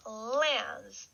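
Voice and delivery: Lens said slowly with a falling end; a clear, slow Lens for repeating; model word Lens. Shown as a demonstration. Teacher models Lens for the class to repeat. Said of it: "we have lens".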